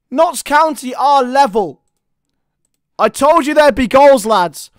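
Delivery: usual speaking voice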